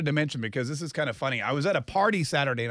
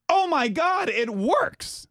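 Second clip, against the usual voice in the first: high-pitched acted amazement; excited exclamation; 11 semitones higher, much slower than usual. Wife seeing the shampoo worked on husband's hair, excited imitation. Wife's delighted discovery, acted in story.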